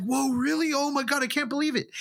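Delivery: high, fast gushing mock amazement; imitated overjoyed disbelief. Imitating the man's overjoyed disbelief when the prank is revealed, high and fast. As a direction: gush it like a thrilled contestant. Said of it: "He was like".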